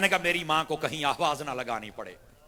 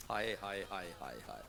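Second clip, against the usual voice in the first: tag; 7 semitones lower, 11 dB quieter, much faster than usual. Real, not acted. Preacher moans in grief.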